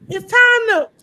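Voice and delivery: high loud shout. Pretend. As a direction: belt it out high. Shouted sign-off.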